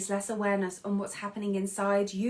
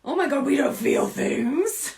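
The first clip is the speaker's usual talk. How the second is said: slow, higher mock-prim grown-up; mock stuffy adult; 4 semitones higher, 4 dB louder than usual. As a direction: lightly play the shocked adult. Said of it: "And the adult's like".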